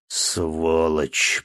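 ordinary delivery, nothing marked

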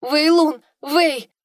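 high, loud cry for help; calling out. Acted. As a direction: call out for help. Princess cries for her guard.

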